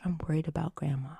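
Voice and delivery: hushed, almost whispered confession; imitating worried child. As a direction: confide a worry very softly, like a tearful child. Tearful child confides, retold.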